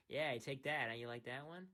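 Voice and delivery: ordinary talk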